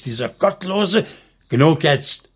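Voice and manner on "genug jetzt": firm command, a touch louder; stern order to end the quarrel. A narrator's reading. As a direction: cut them off with authority. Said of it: "befahl Josua".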